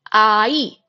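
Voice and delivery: "ai" said as two clear vowels, a then e, no ing sound; pronounced as ai. Shown as a demonstration. Teacher showing how the word for child sounds, vowels only. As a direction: say "ai" with a silent ing, just a then e. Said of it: "it's pronounced as".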